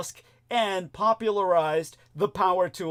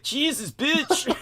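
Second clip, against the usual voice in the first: imitating George; 10 semitones higher, slower than usual. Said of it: "he was like".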